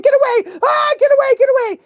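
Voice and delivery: high, loud panicked yelps; mock freaking out. Petrified new dog warding others off, mock panic. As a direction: yelp it like a frantic dog. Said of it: "he's just freaking out".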